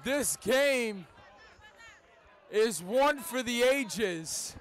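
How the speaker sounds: roaring grand slam home run call; radio baseball announcer. Real, not acted. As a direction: yell it out as the ball leaves the park.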